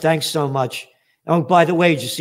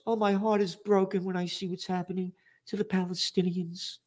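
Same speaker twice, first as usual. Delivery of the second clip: soft, higher-pitched mock lament; mock sorrow; 4 semitones higher, 8 dB quieter than usual. Mocking an official's heartbreak at Davos, falsely sorrowful and soft. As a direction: feign heartbreak in a hushed, imitating voice.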